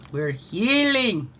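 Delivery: drawn-out rising cheer; exclaimed affirmation. Real, not acted. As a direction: proclaim it, stretching out the key word. Same affirmation, exclaimed then calm.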